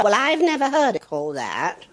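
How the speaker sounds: normal talking voice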